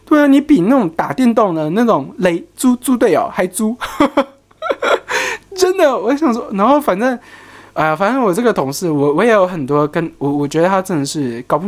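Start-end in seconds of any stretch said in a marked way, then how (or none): 4.6-5.5 high laugh. Real, not acted. Laughing at own story.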